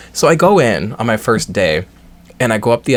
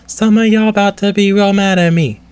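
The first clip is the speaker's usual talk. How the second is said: flat robotic voice, higher pitch; 9 semitones higher, 5 dB louder than usual. Retold or imitated. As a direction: speak like a stiff robot. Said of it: "she has a robot voice".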